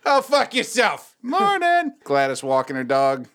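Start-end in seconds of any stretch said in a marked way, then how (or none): 0.1-1.9 high, drawn-out cheery singsong; mock friendly neighbor. Neighbor greeting Gladys on her walk, high cheery mock call. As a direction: sing out the insult like a greeting.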